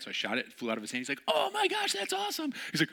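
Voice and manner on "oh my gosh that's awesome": very high squealing delight; thrilled squeal. Retold or imitated. Thrilled friend squealing at the shot, very high. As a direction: squeal with joy.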